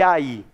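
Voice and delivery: "aí" em duas sílabas, com força no "i"; vogais separadas. Shown as a demonstration. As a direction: separe "aí": a, depois i tônico. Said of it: "Eu falo o som separado". ("aí" com os sons separados)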